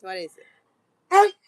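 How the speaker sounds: loud, slow rising question to the dog; loud pet-talk call; loud drawn-out call. Real, not acted. Talking to the dog.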